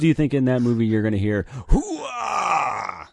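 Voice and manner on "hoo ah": wrestler shout. Retold or imitated. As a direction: bellow it like a wrestler. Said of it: "from Roman Reigns".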